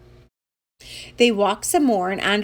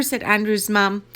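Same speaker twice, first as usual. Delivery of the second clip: ordinary delivery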